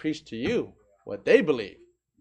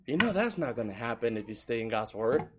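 normal talking voice

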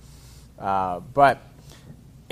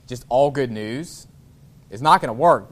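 ordinary talk, nothing marked

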